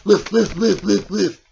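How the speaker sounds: quick spoken woofs; dog barking. Acted. Dog puppet greeting its friends, playful barking.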